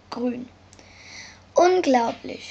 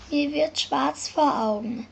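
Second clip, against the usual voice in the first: plain reading voice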